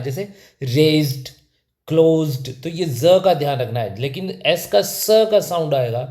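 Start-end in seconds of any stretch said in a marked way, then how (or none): 0.0-2.5 "रेज्ड" and "क्लोज्ड" with z before d; teacher's careful "रेज्ड", "क्लोज्ड", one syllable each; voiced z sound. Shown as a demonstration. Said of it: "वो है ज का आ रहा है".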